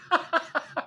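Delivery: high-pitched laugh. Real, not acted. Reader amused by an Irish comedian's joke, high laugh. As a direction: burst of real laughter.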